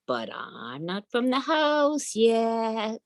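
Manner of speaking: high, slow little dog voice; sly little dog. Acted. Little dog answers the talking gate, high and slow. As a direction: say it slowly like a clever puppy.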